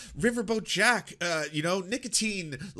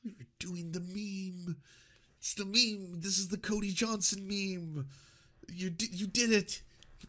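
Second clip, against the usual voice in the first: stunned hush; 8 dB quieter than usual. Real, not acted. Quiet stunned reaction.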